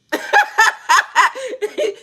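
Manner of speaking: high cackle falling away; high laugh. Real, not acted. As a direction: laugh high, then let it fall. Laughing at herself.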